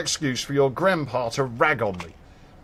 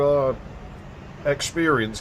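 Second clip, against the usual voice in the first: ordinary delivery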